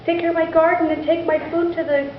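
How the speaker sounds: high, worried lament; a mother beside herself with worry; lightly voiced fretting mother; distraught mother. A narrator's reading. Distraught mother laments son's hands.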